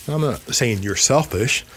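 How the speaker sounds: ordinary talk, nothing marked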